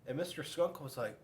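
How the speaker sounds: ordinary delivery, nothing marked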